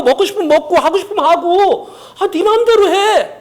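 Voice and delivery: high, coaxing devil's whisper-sell; tempting devil. Acted. (높고 달콤하게 꾀는 마귀 목소리)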